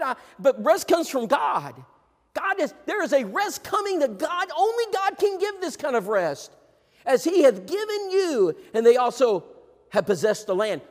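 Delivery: high, loud proclaiming; preached scripture reading; forceful scripture preaching. Acted. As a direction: thunder the verses out to the congregation.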